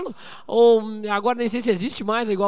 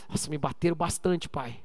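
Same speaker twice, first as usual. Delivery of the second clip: quiet, low little complaint; a tired kid muttering to dad; son's soft complaint; 7 semitones lower, 8 dB quieter than usual. Retold or imitated. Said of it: "ele falou". (filho reclamando baixinho ao pai)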